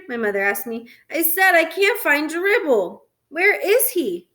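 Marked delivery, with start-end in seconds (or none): 1.1-4.3 higher, slow, emphatic complaint; exasperated. A narrator's reading. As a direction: spell it out to Mom, frustrated. Boy frantic over missing turtle.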